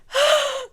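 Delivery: high excited gasp; sudden high-pitched gasp; sharp gasp. Real, not acted. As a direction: gasp in delight. Reacting to the song.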